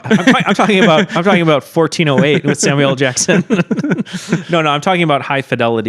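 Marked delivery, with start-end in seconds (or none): 0.0-3.5 laughing. Pretend. Joking wrong movie pick.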